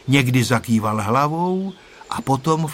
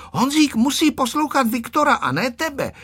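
put-on high, scolding little girl; stern voice; 13 semitones higher than usual. A narrator's reading. Tereska sternly scolding Ferda. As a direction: tell him off sharply. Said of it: "řekla přísným hlasem Tereska".

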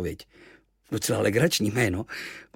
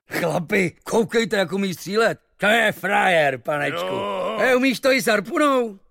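much higher, louder excited sailor voice; admiring sailors; 11 semitones higher, 5 dB louder than usual. Acted. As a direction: marvel at his shooting.

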